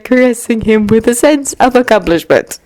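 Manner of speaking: usual speaking voice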